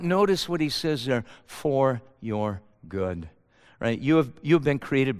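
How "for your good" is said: low, soft, slow for weight; quiet, slow emphasis on the verse; hushed emphasis. Acted. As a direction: drop it low and let it land. Key phrase of the verse.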